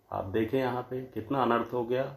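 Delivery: ordinary talk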